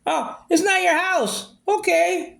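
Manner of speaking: high, slow mimicry of her excuse; mocking her words. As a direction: drag out her line in a high sneering voice. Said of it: "mimicking her line again".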